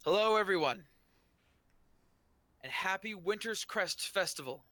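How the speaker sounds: loud, high-pitched public greeting; ringing announcer-style welcome from a podium; booming voice. Acted. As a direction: boom it out to a festival crowd. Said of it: "the voice booms".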